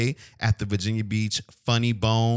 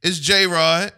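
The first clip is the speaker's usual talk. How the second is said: loud, raised announcer call; show intro call; 5 semitones higher, 7 dB louder than usual. Real, not acted. Host introducing himself on the podcast, loud intro call. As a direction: belt out your name to open the show.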